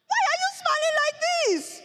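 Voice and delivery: very high, playful squealed question; teasing squeal. Acted. Sister asking brother about his smile, squealing. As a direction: squeal it at him in childish delight.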